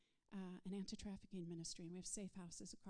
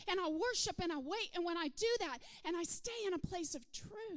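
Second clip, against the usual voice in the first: louder and higher, building declarations; preaching at full force; 9 semitones higher, 9 dB louder than usual. Acted. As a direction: proclaim each truth with rising passion. Rising litany of declarations.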